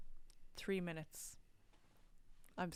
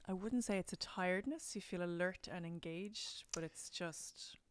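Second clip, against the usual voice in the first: ordinary talk, nothing marked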